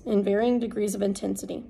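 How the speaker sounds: ordinary delivery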